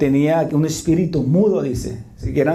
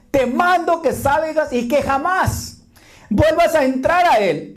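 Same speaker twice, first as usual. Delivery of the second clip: high, slow rebuke of the spirit; Jesus ordering the mute spirit out, slow and high; Jesus commanding; 10 semitones higher, slower than usual. Acted. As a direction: cast the spirit out with authority.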